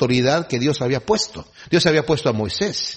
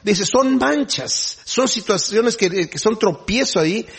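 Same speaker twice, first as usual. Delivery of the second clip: slow, higher solemn reading voice; a preacher slowly reads the verse to the congregation; scripture reading; 6 semitones higher than usual. Acted.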